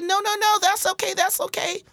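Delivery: high, eager no-no-no; high reassuring brush-off. Retold or imitated. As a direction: wave off their worry, brightly. Reassuring dog owners, retold.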